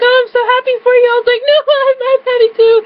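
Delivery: high, excited gushing into the phone; giddy high-pitched delight; overjoyed. Retold or imitated. Acts out shared excitement on phone.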